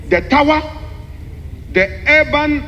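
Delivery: ordinary talk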